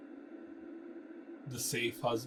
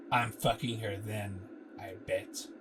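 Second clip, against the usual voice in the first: low bro voice; 4 semitones lower than usual. Acted. Frank brags about his date.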